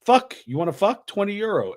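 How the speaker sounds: high, blunt mock come-on; put-on high voice pitching the offer; soliciting woman. Street woman soliciting, retold.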